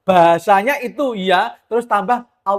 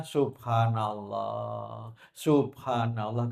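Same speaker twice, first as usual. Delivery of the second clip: soft dhikr; 8 semitones lower, 10 dB quieter, much slower than usual. Shown as a demonstration.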